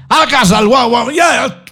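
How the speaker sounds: a hammed-up mother yelling to hush the baby; scolding mother. Mother scolding about crying baby.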